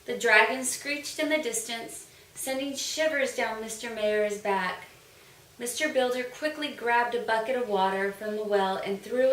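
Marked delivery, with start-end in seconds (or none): none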